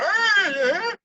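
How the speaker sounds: high, loud otter squeaks; squeaky animal cries, rising then falling; otter chatter. Acted. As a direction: chirp like an excited otter. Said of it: "He goes".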